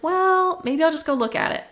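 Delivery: high, lilting tentative thought; musing aloud. Retold or imitated. Buyer talking herself into viewing a cottage, high-pitched musing. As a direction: pitch it up like a casual idea.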